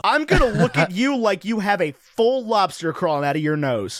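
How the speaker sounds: ordinary delivery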